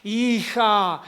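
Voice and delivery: hammed-up divine summons; audible voice of God.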